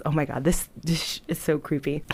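ordinary delivery

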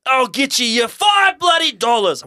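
loud, high, slow Australian holler; accidental Australian accent. Acted. Eddie promises the ghost his money, shouted Australian accent. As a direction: yell it in a hammed-up Australian accent. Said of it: "Why did I go Australian?".

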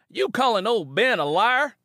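Jed snarls at Simi; growling Jed. Acted. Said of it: "Jed growled".

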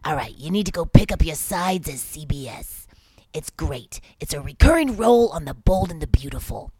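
mock talent-manager pitch; imitating the manager. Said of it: "he talked like this".

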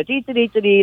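sung Pac-Man chomping noise; chirpy dee dee game sound. Retold or imitated. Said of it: "like little Pac-Man".

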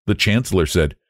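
usual speaking voice

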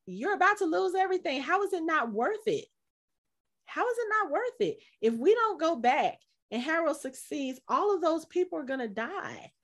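high, pressing retold accusation; mock angry confrontation. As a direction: push him hard with disbelief. Stephen confronting Mark, retold.

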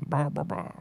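mock British babble. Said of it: "He's like".

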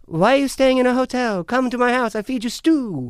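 high, loud French villager impression; insistent countryside host. Countryside French inviting a traveler home, high insistent host. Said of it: "they're like".

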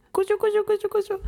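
very high playful tickle noise; tickling sing-song. Acted. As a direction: tease her with a squeaky tickle. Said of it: "どうぞ".

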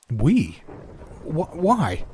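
alarmed, stammering, pitch jumping up; a touch of frightened stammer. Acted. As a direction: stumble over the words in mild alarm. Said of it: "Halverson stammered".

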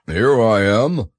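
slow, loud growly bear. A narrator's reading. Pooh answers Christopher Robin from behind, growly voice. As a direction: growl it out slowly and loudly. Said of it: "said a growly voice behind him".

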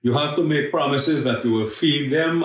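normal talking voice

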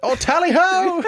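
loud, high British caricature; mock British accent. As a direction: whoop it like a hammed-up Englishman. Caps the mock British bit.

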